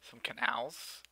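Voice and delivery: breathy whisper, barely voiced. Real, not acted. Player reading the build menu in a whisper. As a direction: murmur it under your breath.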